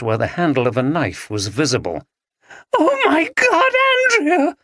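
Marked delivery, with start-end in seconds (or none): 2.8-4.6 very high, crying out in anguish. Acted. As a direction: wail her friend's name. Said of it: "cried Maggie".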